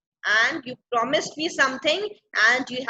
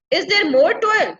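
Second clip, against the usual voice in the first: higher, louder questioning protest; unhappy complaint; 5 semitones higher, 7 dB louder than usual. Acted. Ariel questions Prospero's new task, unhappy complaint. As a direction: protest the extra toil, mildly put out. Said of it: "like, not very happy now".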